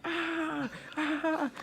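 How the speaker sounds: very high frightened squeals; panicked squeals. Retold or imitated. Kid running from the devil, squealing high. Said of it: "and I'm like".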